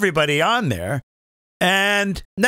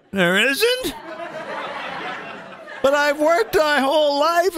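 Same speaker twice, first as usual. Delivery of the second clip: high, slow disbelief; hammed-up crushed dismay; shocked whine; 10 semitones higher, slower than usual. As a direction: plead in stunned surprise. Voicing his shocked younger self.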